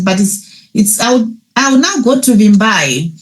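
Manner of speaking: usual speaking voice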